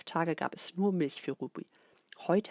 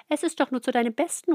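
higher, louder, fast mother voice; coaxing mother; 5 semitones higher, 7 dB louder, faster than usual. A narrator's reading. Mama placates screaming Ruby, high coaxing voice. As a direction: soothe the crying baby quickly. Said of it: "sagt Mama".

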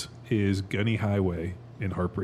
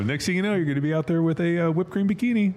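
teasing; 7 semitones higher, 3 dB louder, faster than usual. Acted. Teasing excitedly.

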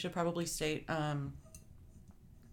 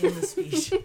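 mock news cadence; 12 semitones higher, 8 dB louder than usual. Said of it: "It's the pause".

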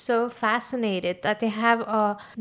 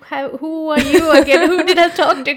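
laughing loudly at herself; 6 semitones higher, 14 dB louder than usual. Real, not acted. To the host, laughing loudly about forgetting every name. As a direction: laugh through the words, embarrassed.

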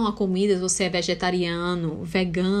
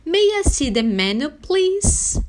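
higher, clearly enunciated English example with rising please; model phrase; 7 semitones higher, 4 dB louder, slower than usual. Shown as a demonstration. Teacher modelling how to ask for the menu, clear and high. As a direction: teach it as a polite request, lifting please. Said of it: "você pode dizer assim".

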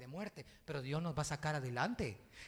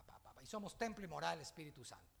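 ordinary delivery

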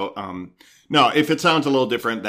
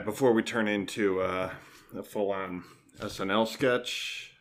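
ordinary talk, nothing marked

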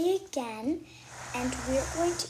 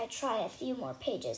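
ordinary delivery

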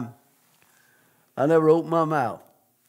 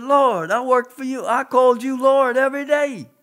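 higher and louder, insistent; urgent protest; 9 semitones higher, 4 dB louder than usual. Retold or imitated. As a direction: protest your devotion to the Lord. Preacher defends himself to God.

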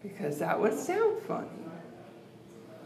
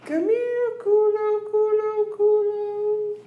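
very high, louder sing-song calling of culo; much higher mock coaxing; 5 dB louder than usual. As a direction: call it like luring a pet, squeaky high. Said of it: "Do you say, like".

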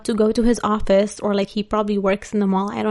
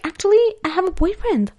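much higher-pitched polite letdown; retold polite excuse; 9 semitones higher than usual. Woman turns down a flirting stranger, acted in high polite voice. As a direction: pitch it up high, letting him down.

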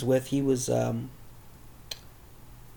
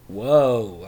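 mock surprise; 7 dB louder than usual. Stepdad reacting to attitude.

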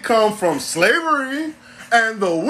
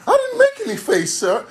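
very high, meek denial; hammed-up squeaky excuse to the master; frightened slave.

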